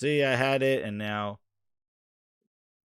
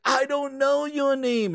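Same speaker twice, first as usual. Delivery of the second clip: mock Master Chief; 10 semitones higher, slower than usual. Master Chief in parody scene.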